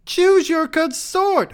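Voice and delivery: very high, loud, drawn-out shriek; theatrical high-pitched yell; screamed demand. A narrator's reading. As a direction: scream it slowly as a command. She screams commands at him.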